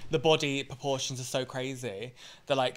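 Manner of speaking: ordinary talk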